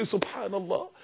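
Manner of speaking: low, soft murmur of amazement; quietly marvelling to oneself; hushed wonder. Retold or imitated. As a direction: say it under the breath in awe. Hushed reaction, then said again normally.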